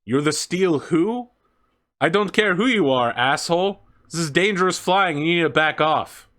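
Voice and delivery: captain berating a reckless pilot, angry. Acted. Captain yelling at hostile ship.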